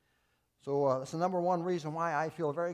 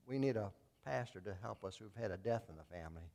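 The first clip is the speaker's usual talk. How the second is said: mock weeping; 5 semitones lower, 5 dB quieter than usual. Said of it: "kinda weeping".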